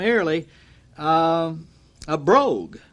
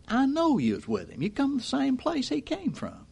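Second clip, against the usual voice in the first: high-pitched voice of an accusing bystander; acted accuser; 9 semitones higher, 6 dB quieter than usual. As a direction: pitch it up as a bystander pointing Peter out. Accuser acted identifying Peter.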